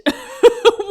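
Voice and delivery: high loud laugh; laughing at her own husband imitation, loud high; burst of laughter. Real, not acted. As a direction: crack up.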